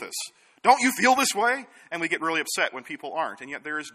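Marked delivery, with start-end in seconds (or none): 0.6-1.7 high, loud indignant question; outraged appeal. Retold or imitated. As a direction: demand that others share your outrage. Outraged person seeks agreement.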